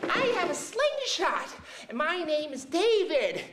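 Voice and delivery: high, squeaky little-guy voice. Retold or imitated. Little David greeting the others, high and squeaky. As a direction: pipe up small and eager. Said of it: "and he's like".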